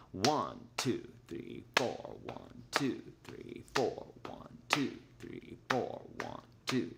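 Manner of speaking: soft, half-whispered one two three four; counting in four. Shown as a demonstration. Teacher showing the emphasis every four beats, whispered. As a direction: breathe the count, leaning on one. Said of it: "every four beats you have the emphasis".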